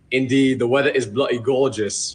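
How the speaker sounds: low, put-on British accent; hammed-up British small talk; copied British accent. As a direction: fake being British, overdoing it. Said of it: "talk in that exact same accent back".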